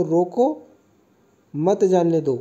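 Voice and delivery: रोको rising, long pause, मत जाने दो falling; pause after रोको. Shown as a demonstration. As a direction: break right after "रोको", then say "मत जाने दो" firmly as one group. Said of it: "कहा जा रहा है कि इसको रोको". ("रोको" पर रुककर "मत जाने दो")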